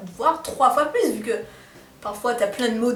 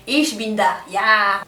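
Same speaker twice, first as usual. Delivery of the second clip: put-on clumsy German accent, slowed down; emphatic, badly faked German; 3 semitones lower, 4 dB louder, much slower than usual. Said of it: "mon accent allemand est merdique".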